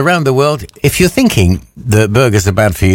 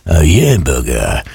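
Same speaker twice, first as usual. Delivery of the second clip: low slow delivery; 5 semitones lower, slower than usual. Shown as a demonstration. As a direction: lower and slow, drawn out. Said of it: "you can just ask for a Yeah Burger".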